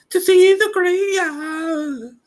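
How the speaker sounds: high and slow with wonder; high girl voice, slow. Acted. Dorothy telling the man her goal, slow and high. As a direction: draw it out high, in awe.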